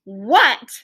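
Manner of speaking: ordinary talk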